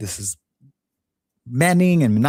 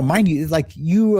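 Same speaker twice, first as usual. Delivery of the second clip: ordinary talk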